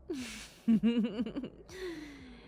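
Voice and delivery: tipsy high giggle; drunk giggle. Acted. Drunk giggle after confessing.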